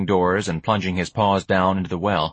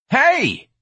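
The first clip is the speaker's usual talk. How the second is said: loud, high-pitched yell; shouting; 5 dB louder than usual. Acted. George sees his package ripped, shouting. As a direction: shout it sharply in protest. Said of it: "George shouted".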